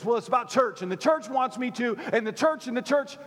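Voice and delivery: mock grumble about the church; mock complaining member. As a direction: parody the church-focused grumbler. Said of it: "some of you look at this".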